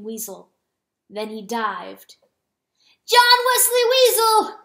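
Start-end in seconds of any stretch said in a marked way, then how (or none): 3.1-4.6 high, loud shrieking call of the name. Acted. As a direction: shriek the name across the field. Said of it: "Chanticleer shrieked".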